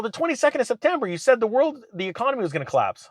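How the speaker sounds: high, fast mock-accusing recap; teasing friend. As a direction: rattle it off to tease. Friend quoting the prediction back.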